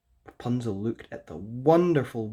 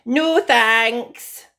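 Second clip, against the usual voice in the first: high, loud haughty princess refusal; Rapunzel's reply; 12 semitones higher, 11 dB louder than usual. A narrator's reading. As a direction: brush him off from the window. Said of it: "she replied".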